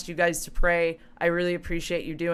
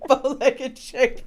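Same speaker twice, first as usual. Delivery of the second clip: slow words through giggles; high, helpless amused repeat; laughing; 4 semitones higher, much slower than usual. Real, not acted. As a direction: squeeze it out while cracking up. Laughing at the misheard word.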